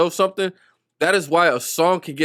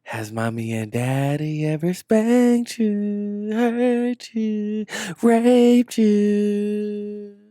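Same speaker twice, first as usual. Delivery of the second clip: slow, drawn-out eerie voice climbing high; mock creepy hidden voice; 7 semitones higher, much slower than usual. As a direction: imitate a distorted message, stretching the words. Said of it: "some sort of distorted voice".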